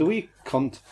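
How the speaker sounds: high comic Irish-ish voice; a failed Scottish that drifts Irish; hammed-up Irish-sounding accent; Irish accent by mistake. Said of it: "that was Irish and the wrong word".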